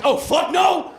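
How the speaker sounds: high, loud outburst of refusal; appalled shout. Acted. Delivery guy walks in on him, appalled shout. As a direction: recoil and yell it.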